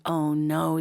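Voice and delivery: soft, low, deflated; sad oh no. Retold or imitated. Seeing the unsold weird dog, soft and heartbroken. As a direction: let your heart sink.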